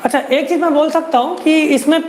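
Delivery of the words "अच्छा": normal talking voice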